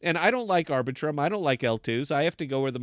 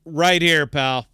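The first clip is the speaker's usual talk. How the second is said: louder confrontational jab; angry outburst; 2 semitones higher, 6 dB louder than usual. Real, not acted. Host rants at the Stride team, louder and angry. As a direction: call them out hard.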